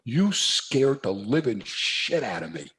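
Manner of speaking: slow blunt confession to the officer; slow and earnest; slow, deliberate. Retold or imitated. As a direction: lay it out word by word, slowly. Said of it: "And I said".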